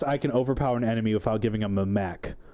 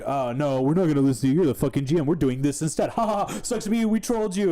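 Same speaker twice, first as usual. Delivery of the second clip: mocking players; 5 semitones higher than usual. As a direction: taunt like a smug troll. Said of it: "And then they'd be like".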